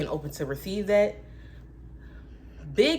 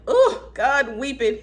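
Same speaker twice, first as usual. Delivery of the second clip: high, loud wail; hammed-up weeping plea; mock weeping prayer; 7 semitones higher, 8 dB louder, slower than usual. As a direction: cry out to God in mock despair. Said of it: "the second I'm like".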